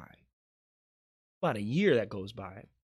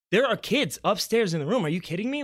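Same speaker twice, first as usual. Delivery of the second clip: insistent disbelief; 9 semitones higher, 7 dB louder than usual. Retold or imitated. Arguing sisters, mom insists.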